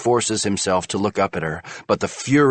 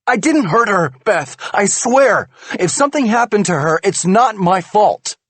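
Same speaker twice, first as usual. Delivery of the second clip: high, loud pleading; desperate plea; 13 semitones higher, 7 dB louder than usual. A narrator's reading. Husband pleads with wife that he didn't hurt the girl, desperate. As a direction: beg her to believe you.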